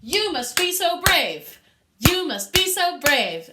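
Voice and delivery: full-voice fortissimo yell; fortissimo shout. Shown as a demonstration. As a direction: yell it as loud as you can. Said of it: "can I hear your loudest voices".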